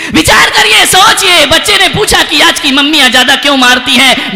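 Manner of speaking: loud, high preaching call; full-force preaching. Acted. Preacher rousing the hall, loud. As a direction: rouse the crowd to think.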